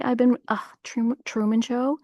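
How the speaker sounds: ordinary delivery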